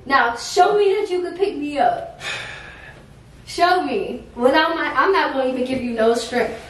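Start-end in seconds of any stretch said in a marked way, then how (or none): none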